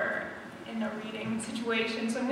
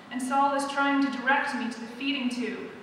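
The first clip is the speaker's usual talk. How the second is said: plain reading voice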